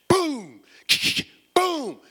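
high, loud laughing boom boom; storm booms with a laugh. Acted. Storm dancing, booming.

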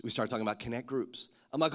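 ordinary talk, nothing marked

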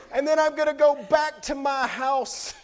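put-on high, loud, eager kid promise; boastful kid promise. Son promising his siblings power, in a high eager voice. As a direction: promise it big like a proud boy. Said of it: "he tells Dewey and Joy".

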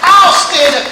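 rising to a loud, high, shouted declaration; bold testimony. Acted. A believer standing up for Jesus before the house, shouting. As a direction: build it into a proud battle cry. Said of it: "who would say".

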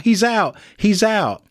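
mild mock yell; mock shout. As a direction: call it out high, like a newsreader quoting. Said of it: "as they shout".

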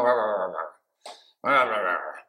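low grumbling rah rah under the breath; muttered rah rah grumble; grumbling rah sounds. Shown as a demonstration. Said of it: "it means smoldering discontent".

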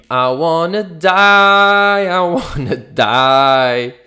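slow, loud drawn-out wail; mock despair wail. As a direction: wail it like a melodrama. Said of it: "E eu, tipo".

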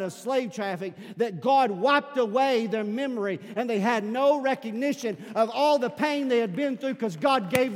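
loud, high, driving sermon delivery; full-force preaching. Real, not acted. Preacher rallying church about lives delivered, impassioned. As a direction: preach it out to the whole room.

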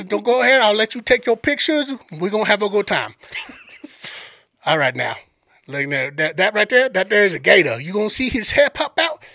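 Cajun tour guide pointing out wildlife; Sambo's Cajun accent. Retold or imitated. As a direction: narrate the sights in a Cajun accent. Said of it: "I'm gonna play this dude's accent".

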